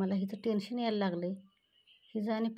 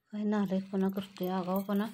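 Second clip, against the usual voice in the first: ordinary delivery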